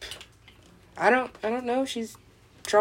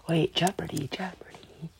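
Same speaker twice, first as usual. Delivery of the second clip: whisper; 3 semitones lower, 5 dB quieter than usual. Real, not acted. Whispered realization.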